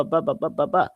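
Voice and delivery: ordinary delivery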